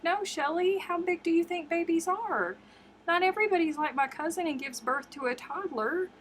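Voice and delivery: teasing her friend with disbelief; incredulous retort. Retold or imitated. Retells scolding Shelly.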